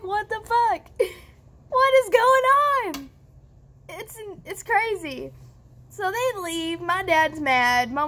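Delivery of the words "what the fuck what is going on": high-pitched incredulous outburst; exasperated, voice jumping way up; baffled outrage. Real, not acted. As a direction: build to a loud, high cry of disbelief. Baffled by police doing nothing.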